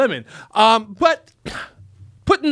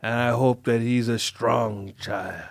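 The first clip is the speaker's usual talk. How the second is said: Godfather impression; 9 semitones lower, 6 dB quieter than usual. Host blessing [name]'s baby, hushed Godfather voice. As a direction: rasp it quietly like a don giving a blessing. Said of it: "That's from The Godfather".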